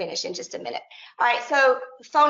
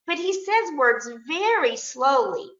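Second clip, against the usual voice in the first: slow storytelling; 6 semitones higher, slower than usual. Retold or imitated. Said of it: "So I tell my students".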